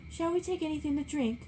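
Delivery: Daisy calls from an upper window; calling from a window. A narrator's reading. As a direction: call down from upstairs. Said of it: "called Daisy from an upper window".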